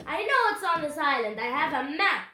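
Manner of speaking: forceful captain's bluster; pirate roar. A narrator's reading. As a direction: roar it with greedy certainty. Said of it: "roared Captain Bones".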